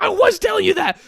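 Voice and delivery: very high, loud indignant retort; mock defensive yell. Friend yelling back defensively, high and loud. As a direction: snap back that you already said it. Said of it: "He's like".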